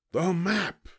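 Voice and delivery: high-pitched alarmed cry; pirate cry. A narrator's reading. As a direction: cry out like a dismayed captain. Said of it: "cried Braidbeard".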